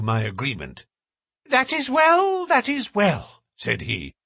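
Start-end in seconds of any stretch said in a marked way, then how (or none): 1.4-2.5 high, thin nervous little man. A narrator's reading. Thaddeus repeating himself. 2.5-3.4 a falling repeat in the thin voice; high, falling repeat. A narrator's reading. Thaddeus repeating himself.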